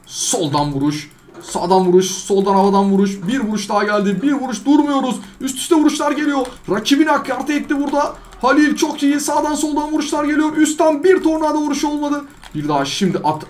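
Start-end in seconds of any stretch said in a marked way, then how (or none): 1.4-12.2 high, fast play-by-play call of the fight; excited live commentator; sports commentary. Acted. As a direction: call the hits like a match announcer. Commentates his own fight.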